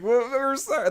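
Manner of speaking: high-pitched fake apology; mock pleading. Villagers bowing to the god of wrath, mock pleading. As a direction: beg lightly for mercy. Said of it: "They're, like, bowing to it".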